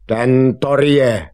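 loud, high, drawn-out cry; slow, irate shout; angry call. Acted. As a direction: call it out crossly, stretching it. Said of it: "zvolá zlostně".

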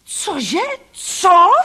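high, outraged yell; incredulous shout, rising to a peak; roared disbelief. A narrator's reading. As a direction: bellow back in furious disbelief. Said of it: "zařval".

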